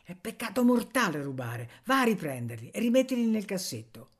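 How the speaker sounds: usual speaking voice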